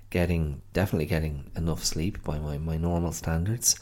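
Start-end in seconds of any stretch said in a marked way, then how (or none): none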